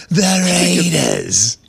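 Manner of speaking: slow, drawn-out announcer call; Berman impression. Showing how Berman really says it, slow and drawn. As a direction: stretch it like a broadcaster. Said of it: "He goes".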